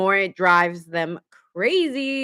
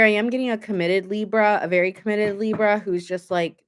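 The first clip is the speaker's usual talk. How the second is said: ordinary talk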